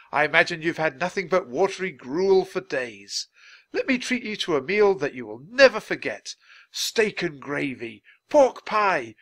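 high, loud, relishing host; expansive Charles. Acted. Charles treats hungry children to a meal, expansive. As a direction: announce the feast with grand, lifted voice.

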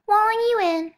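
put-on high, loud childish coaxing; little girl voice. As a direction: call him along like a child. Bonesaw soothing.

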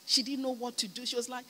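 usual speaking voice

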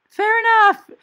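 very high, loud fair enough; loud concession. Retold or imitated. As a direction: shout the concession brightly. Shouted reply in story.

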